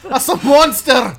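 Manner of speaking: high, loud, laughing fake Scottish cry; a Scot shouting about a monster, mock Scottish accent. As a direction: yell the discovery in a hammed-up Scottish accent. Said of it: "he sounded exactly like either of us".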